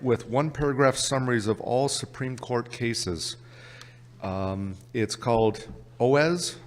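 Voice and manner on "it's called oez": "oez" said as one slow word in a Canadian accent. Real, not acted. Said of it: "My Canadian accent once again".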